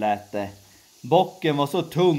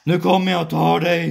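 higher, louder, fast troll threat; troll's shout; 6 semitones higher, 7 dB louder, faster than usual. Acted. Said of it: "skrek trollet". (trollet skriker högt och snabbt)

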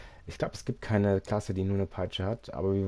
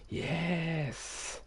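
excited anime fan; 9 semitones higher than usual. Acted. Second yes much higher.

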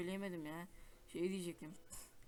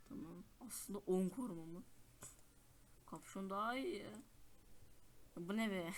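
ordinary talk

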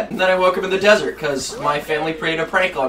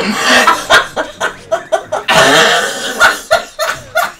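helpless high laughter; can't stop giggling; long giggling fit; 10 semitones higher, 4 dB louder than usual. Real, not acted. Cracking up mid-story.

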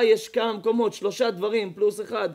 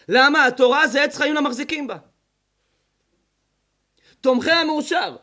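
louder, higher sermon voice; forceful preaching; 5 semitones higher, 6 dB louder than usual. Acted. The rabbi preaching to the congregation, forceful sermon voice. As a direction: drive the point home from the pulpit.

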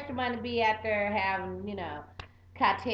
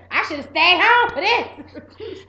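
loud laughing complaint; 8 semitones higher, 12 dB louder, much slower than usual. Retold or imitated. Singers reacting to drill sergeant.

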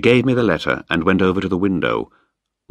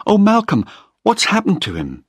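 high, louder cry of Malcolm's name; frightened plea; 4 dB louder than usual. Acted. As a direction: reach for him in alarm. Frightened daughter calls to Malcolm.

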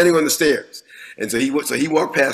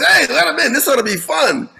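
much higher, louder, laughing; amused buddy; 12 semitones higher, 3 dB louder than usual. Retold or imitated. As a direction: laugh as you wave the kid in. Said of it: "his buddy goes".

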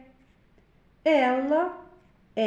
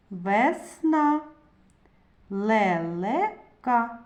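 loud, syllable-by-syllable reading for the class; slow choral reading drill; 4 dB louder, much slower than usual. Acted. Teacher reading syllables to first-graders, loud and deliberate. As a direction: sound out each syllable loudly for first-graders to repeat.

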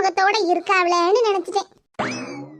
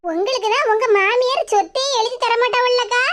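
ordinary talk, nothing marked

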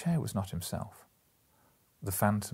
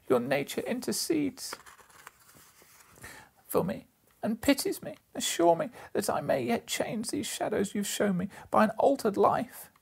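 much higher, imploring plea; high pleading. A narrator's reading. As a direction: beg gently from the ground, pitched up. Scrooge begs on the ground.

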